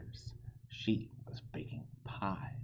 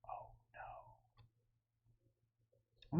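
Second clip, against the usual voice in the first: whispered dismay; 12 dB quieter than usual. Acted.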